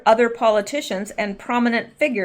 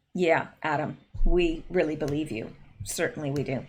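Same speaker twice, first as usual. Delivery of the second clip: sarcastic; 6 semitones lower, 7 dB quieter than usual. Real, not acted. Sarcastic reply to Schiff.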